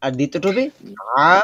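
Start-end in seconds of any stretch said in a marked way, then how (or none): 1.2-1.3 আ said with a thick, heavy voice; deep-throated heavy sound. Shown as a demonstration. Teacher showing students the second letter, heavy sound. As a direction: give আ a dense, heavy sound. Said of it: "গাঢ় আওয়াজ আছে".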